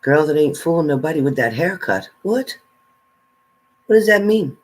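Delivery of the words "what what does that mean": sudden high, startled What?; surprised. Real, not acted. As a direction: caught off guard by the comment. Reacting to a viewer comment.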